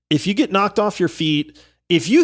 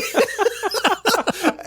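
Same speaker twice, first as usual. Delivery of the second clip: helpless laughter; 12 semitones higher, 5 dB quieter than usual. Real, not acted. Recalling the prank, losing it.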